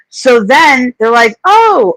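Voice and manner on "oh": high swooping "oh"; mock surprise. Texter learning they have the wrong number, high surprised imitation. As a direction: imitate someone caught off guard, pitched way up.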